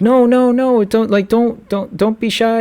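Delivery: high, eager coaxing, no no don't be shy; friend's urging. Retold or imitated. Friend urging him, voiced high.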